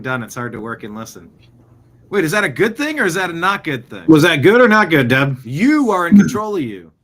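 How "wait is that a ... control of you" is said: teasing call-out. Real, not acted. Teasing co-host Deb.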